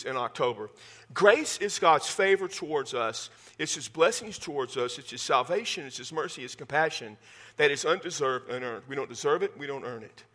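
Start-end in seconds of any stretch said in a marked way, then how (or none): none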